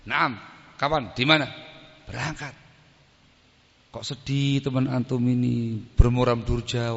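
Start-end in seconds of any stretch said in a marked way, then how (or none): none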